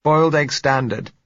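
lightly voiced woman, high and loud; woman calling, muffled. A narrator's reading. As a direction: call it out as a woman behind a door. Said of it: "said her muffled voice".

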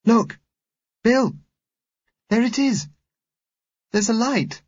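put-on very high, excited child's voice; high, urgent little-girl cry; child calling out. A narrator's reading. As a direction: call out a sudden sighting in a high-pitched child voice. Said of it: "she called out".

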